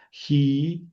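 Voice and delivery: "Ji" alone, long, with an aspirated start; separate syllable. Shown as a demonstration. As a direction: hold "Ji" as a syllable by itself. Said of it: "es otra sílaba".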